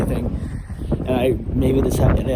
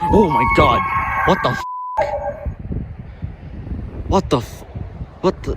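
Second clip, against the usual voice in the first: startled cry; 6 semitones higher, faster than usual. Real, not acted. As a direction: yelp it out in fright. Sudden close noise, fright.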